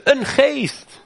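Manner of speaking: high startled cry of a ghost; mock fearful cry. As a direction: cry out like frightened disciples. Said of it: "schreeuwden het uit van angst". (hoge, geschrokken kreet van de leerlingen)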